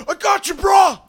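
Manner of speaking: high, loud yell of support; hollered high-pitched backup cry; battle shout. Acted. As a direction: shout it as you charge in after a friend. Game master voices ally charging in.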